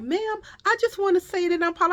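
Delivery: high-pitched mock apology; apologizing store lady. Store lady starting an apology to the customer, mock contrite. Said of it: "And then the lady was like".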